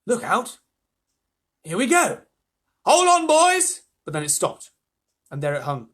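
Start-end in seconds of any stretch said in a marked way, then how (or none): 0.0-3.8 high, loud warnings building; excited shouting. A narrator's reading. Crew warning each other at lift-off, building excited shouts. Crew calling out as peach rises.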